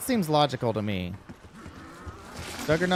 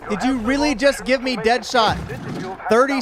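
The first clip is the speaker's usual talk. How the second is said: loud, high disbelieving complaint; indignant outrage; 8 semitones higher, 6 dB louder than usual. Real, not acted. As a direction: shout it at the unfair game. Game gives perk already bought.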